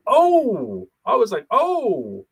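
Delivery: loud, high-pitched retold astonishment; retold shock. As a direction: react like you just saw something unbelievable on TV. Retold reaction watching the slap.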